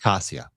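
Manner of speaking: Kasia with a plain s sound; everyday pronunciation. Shown as a demonstration. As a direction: say Kasia as KAH-see-ah. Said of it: "The first one".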